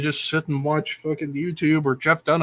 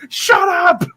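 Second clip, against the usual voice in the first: mock yell; 6 dB louder than usual. Puppet snaps at the son.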